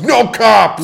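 loud, high cry of no cops; mock shout. Joking that the driver shouts at the cop, high yell. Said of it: "She just goes".

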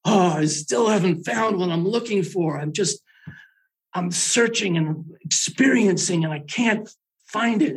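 high, mock mournful rock singer lament. Mocking his friend's song as a high lament. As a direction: whine it like a lament.

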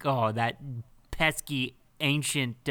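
ordinary delivery

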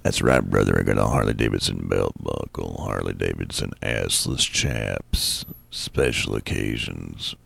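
deep, slow, growling biker dude; comic tells of his Harley accessories as a low, slow biker; biker character. Acted. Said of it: "I become the character".

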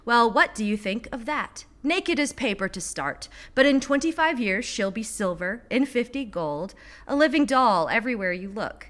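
urgent poetry reading, pitched above casual talk; insistent, emphatic recital with firm line breaks; poem read aloud. Acted. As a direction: recite the poem like a pressing interviewer. Reads poem aloud stressfully.

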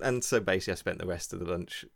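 ordinary talk, nothing marked

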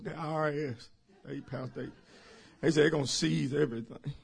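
small, low voice of a worried member; mock tearful confession. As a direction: falter softly through the bad news. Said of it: "I'm sorry for crying".

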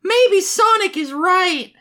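very high, slow cartoon squeak; Tails, timid. Reader voices Tails siding with Sonic, high, slow and timid. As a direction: pipe up like a little cartoon sidekick.

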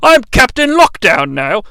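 lightly voiced starship captain; a hint of a grand captain introducing himself; Captain Jean-Luc Picard. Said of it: "Lockdown sounds like Captain Jean-Luc Picard".

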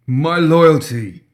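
high, loud, slow cry of pain; cry of agony. A narrator's reading. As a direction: wail it out as if a knife is twisting. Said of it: "he cried in agony".

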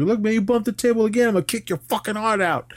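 high, fast hammed-up threat; imitating a hyped-up threat; mock tough threat. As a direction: come at him hard, in a high fast voice. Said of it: "came at him hella hard".

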